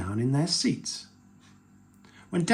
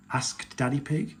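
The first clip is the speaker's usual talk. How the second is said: ordinary delivery, nothing marked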